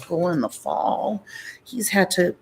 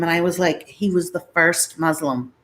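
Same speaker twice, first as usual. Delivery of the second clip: ordinary talk, nothing marked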